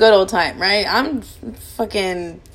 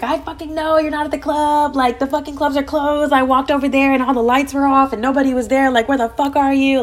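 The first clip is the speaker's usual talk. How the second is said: mock furious dad, pitched higher; angry dad; 6 semitones higher than usual. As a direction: play the fed-up father yelling down the phone. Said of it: "He's pissed".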